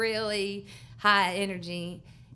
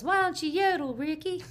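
high, teasing call from the crowd; heckler's yell; 9 semitones higher, 3 dB quieter, much faster than usual. Retold or imitated. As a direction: shout it up from the audience, half laughing. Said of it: "somebody yells".

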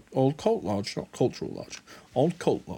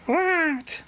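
squeaky high one-word reply; very high put-on hero voice; Lloyd voice; 4 dB louder than usual. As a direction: pitch it absurdly high. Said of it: "Lloyd says".

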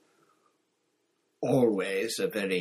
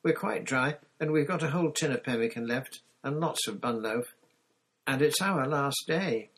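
put-on higher child's pleading; insistent, raised young voice; begging; 5 semitones higher, 4 dB louder than usual. A narrator's reading. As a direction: plead your case like an eager child. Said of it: "begged Titty".

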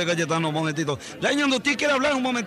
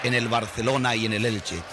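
put-on lower, Castilian-accented broadcaster voice; Spanish radio announcer; 10 semitones lower, 3 dB quieter than usual. Announcer in Madrid hands over to Paraguay, Castilian accent. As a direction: play a pompous Madrid announcer handing over the line.

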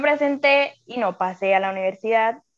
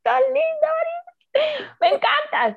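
much higher, gushing delighted reply. Retold or imitated. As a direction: squeal it out with delight. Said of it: "y yo".

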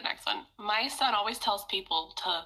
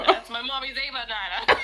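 put-on louder Brooklyn kid accent, laughing through it; 2 semitones higher, 5 dB louder than usual. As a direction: do a little Brooklyn kid proudly announcing. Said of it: "the kids from, like, Brooklyn".

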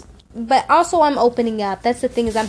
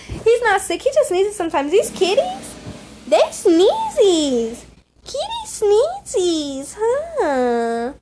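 kitty talk; 9 semitones higher, 4 dB louder, slower than usual. Real, not acted. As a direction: coo at the kittens like babies, much higher. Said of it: "I went off the talking kitty talk".

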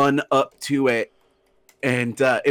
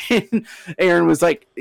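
ordinary talk

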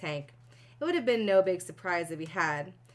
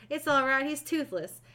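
shouting; 6 semitones higher than usual. Acted.

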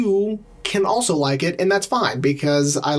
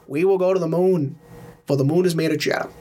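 put-on upper-echelon Boston accent; mock presidential speech in old-money Boston accent; JFK. Demonstrating upper-class Boston to a co-host, Boston accent oratory. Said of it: "the upper echelon of Boston is like".

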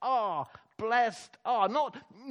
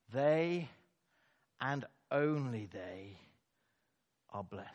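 softer and lower, each word weighed; hushed intensity; 8 semitones lower, 6 dB quieter than usual. Real, not acted. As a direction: let it sink in, hushed. Quiet emphatic conclusion.

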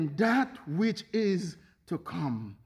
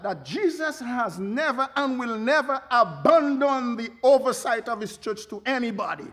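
usual speaking voice

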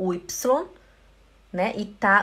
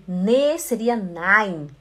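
normal talking voice

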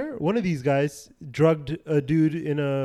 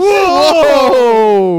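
slow, much higher, louder laughing whoa; drawn-out whoa; 8 semitones higher, 10 dB louder than usual. Retold or imitated. Friend mimicking a crowd's slow amazed whoa. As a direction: stretch the whoa out big. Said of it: "It's like".

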